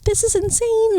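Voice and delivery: high amazed squeal; squealing in disbelief. Retold or imitated. Amazed at friend's kindness.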